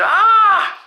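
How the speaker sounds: sudden high shriek; a loud startled scream. A narrator's reading. Janet screaming at her green face. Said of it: "she screamed and said".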